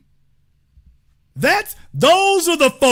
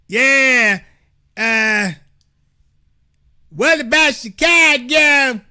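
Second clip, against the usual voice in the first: higher, louder dopey caller voice, dragging it out; mocking a caller; 6 semitones higher, 6 dB louder, much slower than usual. Host mocking a rival show's caller with a high, loud dopey voice. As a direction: lampoon the dim caller by playing him. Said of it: "you hear what they sound like".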